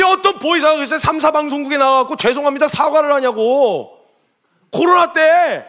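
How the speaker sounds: high, loud, indignant rant. Retold or imitated. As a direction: re-enact railing at the congregation. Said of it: "막 난리를 쳤어요". (격분해서 따지는 호통)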